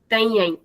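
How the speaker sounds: तैं ऐं as two nasal syllables; doubled nasal sound. Shown as a demonstration. Teacher showing the alternative pronunciation, doubled तैं ऐं. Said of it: "ऐं sound को double कर देते हैं".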